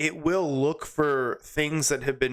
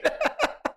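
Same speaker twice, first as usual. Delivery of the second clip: short high-pitched burst of laughter; helpless high laugh; high laughing fit. Real, not acted. Cracks up at the punchline.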